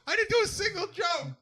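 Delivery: high, frantic shout. Pretend. Repeated louder as shout.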